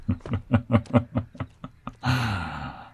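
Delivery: a run of short chuckles, then a long exhale; chuckle, then let the breath out long; amused chuckle. Acted. Cowboy teasing his companion about being rough, amused chuckle.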